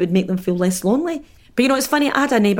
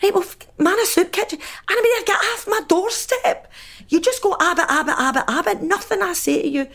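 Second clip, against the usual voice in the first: high-pitched, fed-up telling-off; exasperated retort; 12 semitones higher than usual. Retold or imitated. Fed-up neighbour sending her off the doorstep, high exasperation. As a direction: act out losing patience, voice shooting right up. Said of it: "And I would go".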